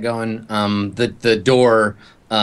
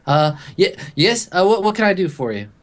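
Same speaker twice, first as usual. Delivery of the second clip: higher, stammering nervous clerk; terrified clerk; 8 semitones higher than usual. Acted. Hotel clerk to the scary guests, higher and terrified. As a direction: squeak it out like a frightened desk clerk. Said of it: "she's terrified".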